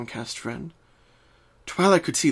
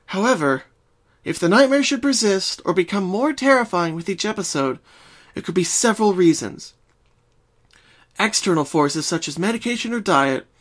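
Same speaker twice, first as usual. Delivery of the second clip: higher, studious reading voice; Twilight reading aloud; 5 semitones higher, 3 dB louder than usual. Acted. Twilight reads the book to Pinkie, studious. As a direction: read it out like a textbook.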